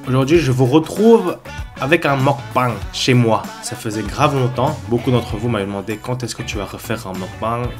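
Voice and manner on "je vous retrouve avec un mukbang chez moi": usual speaking voice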